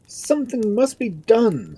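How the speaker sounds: high, loud, slow lordly pronouncement; self-important peer in council; indignant lord. A narrator's reading. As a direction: declare it high and drawn out. Lords in indignant conference.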